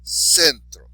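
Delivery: "centro" with a loud, high CEN; emphatic first syllable on "centro"; stress on next-to-last syllable. Shown as a demonstration. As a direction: punch CEN, let tro fall in "centro". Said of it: "the next last is sen".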